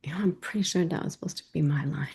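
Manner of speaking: quick, hushed whispered aside; soft, under-the-breath confession to the audience; private thought aloud. Acted. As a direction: whisper it fast as a wry inner thought. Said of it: "I'm sitting there thinking".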